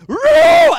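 huge high roaring shout; imitated lion roar. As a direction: roar out like a lion overtaken by the Spirit. Elder roars again.

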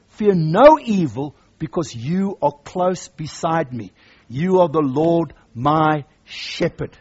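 slow, deliberate fear no evil; slow scripture reading. Acted. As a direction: read it slow and sure. Correct reading of the verse.

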